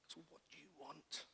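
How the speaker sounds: very soft, searching question; quietly voiced God; hushed divine question. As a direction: drop right down, as God probing gently. Voices God's searching question to him.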